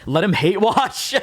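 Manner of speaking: high, slow words broken by real laughter; laughing. Real, not acted. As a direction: laugh through it, too amused to keep a straight face. Laughing at her own joke.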